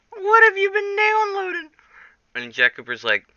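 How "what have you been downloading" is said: high, squeaky caller voice, way up; squeaky tech support voice. Acted. Tech support questions Jack in a squeaky high voice. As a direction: play the tech guy in a silly high squeak.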